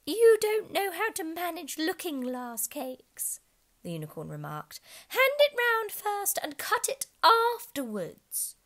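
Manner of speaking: high slow unicorn voice. Acted. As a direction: instruct her high and slowly. Unicorn instructs Alice.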